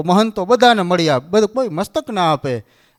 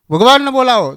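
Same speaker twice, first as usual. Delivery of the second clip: loud, higher impatient demand; angry; 5 semitones higher, 5 dB louder than usual. Retold or imitated. Narad demanding God be called, loud insistence. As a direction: insist sharply. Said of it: "Narad ખીજાણા".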